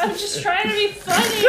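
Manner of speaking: high laughing plea; laughing protest. Real, not acted. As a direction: protest loudly through laughter. Laughing protest about hate mail.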